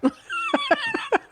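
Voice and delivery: high laugh. Real, not acted.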